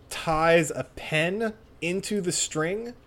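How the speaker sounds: ordinary delivery, nothing marked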